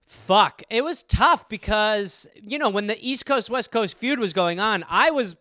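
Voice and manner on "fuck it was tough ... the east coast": normal talking voice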